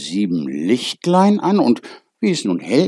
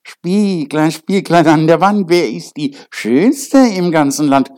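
queen voice; 7 semitones higher, 6 dB louder than usual. A narrator's reading. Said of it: "trat vor ihren Spiegel und sprach".

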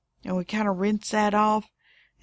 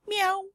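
high, soft imitated meow; cat meow; 10 semitones higher, 4 dB quieter than usual. Mother imitating her cat's small meow to daughter. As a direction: squeak a timid little cat meow.

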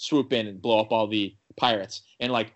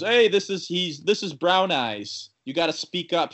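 talking up for a deaf man; 6 semitones higher than usual. Retold or imitated. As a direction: lean in and raise it for a half-deaf listener.

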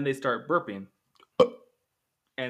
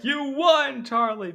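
high, loud joyful cry; excited announcement; 13 semitones higher, 9 dB louder, slower than usual. Retold or imitated. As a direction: burst out with the good news. Wonka announces the winner.

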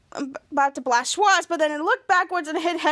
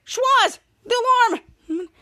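raised, high urgent warning; urgent call; 7 semitones higher, 3 dB louder, much slower than usual. Retold or imitated. Ray shouting to Schwartz about the alarm, urgent call.

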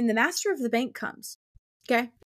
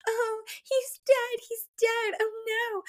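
high, slow fake wail; simpering mock crying; 12 semitones higher, slower than usual. Two women sitting there feigning grief, high simpering wail. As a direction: whimper it in a high, put-on weepy voice. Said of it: "just sitting there simpering and crying and being like".

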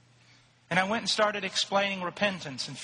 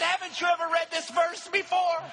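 joyful exclamation; 11 semitones higher than usual. Retold or imitated.

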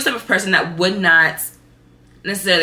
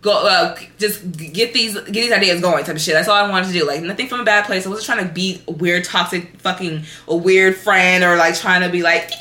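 ordinary delivery

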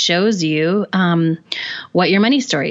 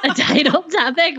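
laughing; 8 semitones higher than usual. Real, not acted. Teasing repeat of odd phrase.

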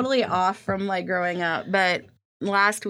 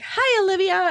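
high, chirpy mock greeting from the uncle; uncle's greeting; 12 semitones higher, 4 dB louder than usual. Uncle greets niece-in-law by wrong name, high mock voice. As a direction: lightly imitate the clueless uncle. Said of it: "and he was like".

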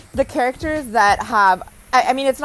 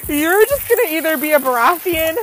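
higher, louder dramatic declaration; mock ultimatum; 7 semitones higher, 5 dB louder than usual. Wife giving husband a Game of Thrones ultimatum, hammed-up. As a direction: deliver the breakup line like a soap opera. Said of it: "I'm like".

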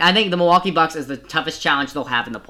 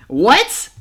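surprised; 7 semitones higher, 6 dB louder than usual. Real, not acted. Laughing at his own bad prediction.

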